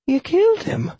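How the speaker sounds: tiny, very high woman's voice. A narrator's reading. Widow to the man who killed before her, tiny high voice. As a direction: squeak it out small and high. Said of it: "I heard a tiny voice say".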